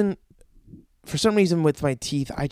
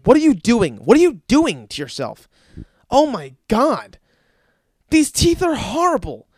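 acted appalled scolding, higher at the end; upset dentist; 4 semitones higher, 4 dB louder than usual. Dentist appalled by the patient's teeth, mock-upset scolding. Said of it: "And they get upset".

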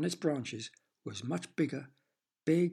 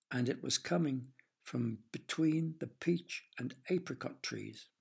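usual speaking voice

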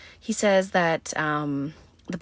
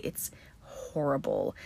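softer, slow whisper; 3 semitones lower, 7 dB quieter than usual. Real, not acted. Recoiling from the gore, in a slow whisper. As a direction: breathe it out, horrified.

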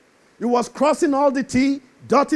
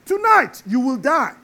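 God's stern voice; 6 semitones higher than usual. Retold or imitated. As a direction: proclaim it like God condemning the fool. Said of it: "God said".

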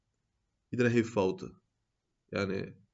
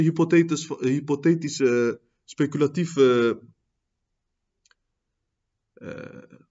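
preach; 6 semitones higher, 6 dB louder than usual. Acted.

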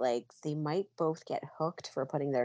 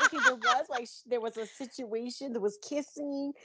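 excited recap; 5 semitones higher, 5 dB louder than usual. Retold or imitated. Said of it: "Okay, I was like".

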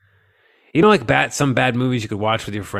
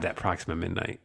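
normal talking voice

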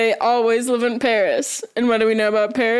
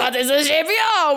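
laughing mock French; faster than usual. Townsfolk marvel at bread.

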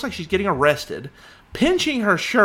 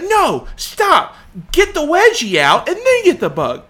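raised, louder bossy commands; imitated yelling; 7 semitones higher, 6 dB louder than usual. Wife ordering husband to fix the wedgie, imitated yelling. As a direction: mimic an exasperated wife giving orders. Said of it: "she's yelling at me".

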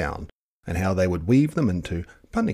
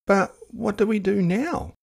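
put-on higher questioning child voice; girl asking; 14 semitones higher than usual. A narrator's reading. Girl asking her grandparents what to do, high. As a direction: ask it as an eager young girl. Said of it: "Te Rere Hua asked".

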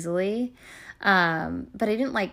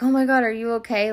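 louder, quick imitation of a worried partner; alarmed concern; 4 semitones higher, 6 dB louder, faster than usual. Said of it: "I could tell it looked bad by his voice".

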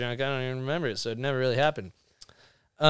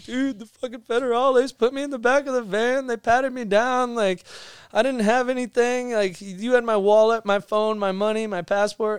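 imitating crying friend; 9 semitones higher than usual. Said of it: "comes back crying ten minutes later".

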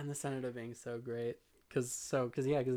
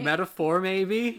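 loud, raised-pitch hammed-up hint; mock questioning; 7 semitones higher, 13 dB louder, slower than usual. Real, not acted. Host pointing at obvious foreshadowing, mock questioning.

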